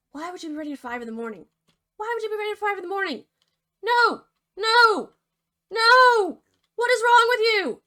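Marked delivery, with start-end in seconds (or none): 1.9-3.2 much higher, fast, squeaky outrage; exasperated disbelief. Real, not acted. Narrator repeats it at the pig, high and exasperated. Narrator mocks the pig's answer. 3.8-7.8 much higher, very loud repeated no's; exasperated shouting. Real, not acted. Narrator yells at the pig, high, loud and exasperated.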